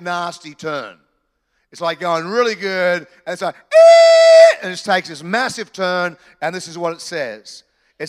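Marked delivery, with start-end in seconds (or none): 3.5-4.6 a huge sudden yelled "eh" far above the voice; sudden shout. Shown as a demonstration. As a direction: blast out a sharp cry to show the shock. A sudden massive turn.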